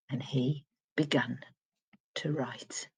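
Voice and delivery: hushed. A narrator's reading. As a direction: drop to a secret whisper. Narrator hushes at climax.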